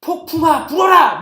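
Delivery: loud, high shouted command; mighty command. Acted. Paul commanding the storm with a single word, shouting. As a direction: summon the storm at full voice. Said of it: "말 한마디에".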